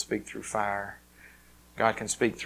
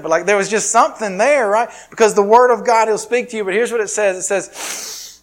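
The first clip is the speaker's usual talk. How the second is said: high, loud, driving preacher voice; preaching at full force; 7 semitones higher, 11 dB louder than usual. Acted. Pastor preaching scripture to the church, loud and fervent. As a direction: preach it out to the congregation. Preaching about God's word.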